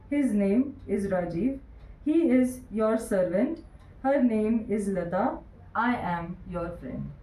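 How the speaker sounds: slow, even reading with pauses between sentences; unhurried dictation pace. Shown as a demonstration. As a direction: read it out slowly so the class can match. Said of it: "I'll read the correct answer slowly so that you may match it".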